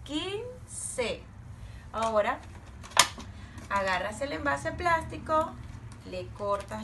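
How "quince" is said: higher, drawn-out model word; model word to repeat. Shown as a demonstration. Teacher modelling a word for children, raised pitch. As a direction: sing it out high for them to copy. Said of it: "¿Pueden decir quince?".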